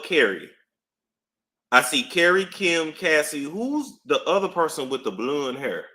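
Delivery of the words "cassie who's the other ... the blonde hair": normal talking voice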